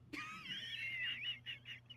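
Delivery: squealing embarrassed giggle; high squeaky laugh. Real, not acted. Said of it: "I need to work on my Bugs impression".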